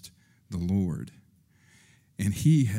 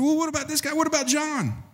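high, nagging voice for Peter; whiny high Peter; faster than usual. Acted. Peter nags Jesus about John, in a high whine.

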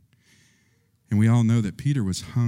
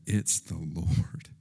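hushed awe; 6 semitones lower, 7 dB quieter, slower than usual. Acted. John recognizing Jesus from boat.

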